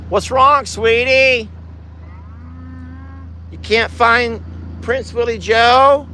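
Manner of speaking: high, sing-out pet-talk; cooing sympathy. Real, not acted. Owner comforts a bellowing cow missing another, cooing.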